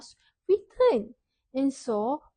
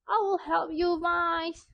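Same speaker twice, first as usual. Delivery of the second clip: frog's voice; 5 semitones higher than usual. Acted. Frog speaks in the story.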